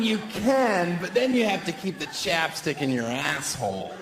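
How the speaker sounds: ordinary delivery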